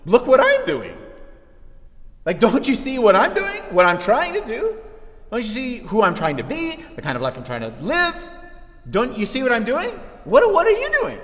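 high, insistent protest with rising questions; acted indignant pleading. Preacher as works-righteous believer pleading with God, high indignant complaint. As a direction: plead your own case, voice climbing.

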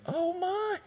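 soft, high gasp of awe; hushed high surprise. Retold or imitated. Listener reacting to the story, hushed awed surprise. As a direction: breathe it out in quiet shock. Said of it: "you're like".

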